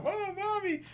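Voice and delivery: very high mock child wail; kid yelling. Kid crying out for shot mother, high mock yell. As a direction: squeak it out like a small kid. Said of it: "their kid starts yelling out".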